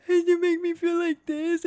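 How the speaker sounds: high, drawn-out tearful wail; exaggerated crying while trying to sound angry; mock bawling. Creator imitating themself crying in an argument, high mock bawling. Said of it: "bawling my eyes out".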